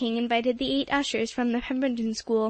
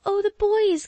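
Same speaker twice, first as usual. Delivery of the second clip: high, louder gasped cry; startled gasp; 8 semitones higher, 5 dB louder than usual. A narrator's reading. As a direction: gasp it out in sudden alarm. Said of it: "gasped Polly".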